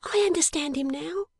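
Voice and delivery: put-on high, light girlish voice; a small eager young woman; little dressmaker. Jenny's high voice.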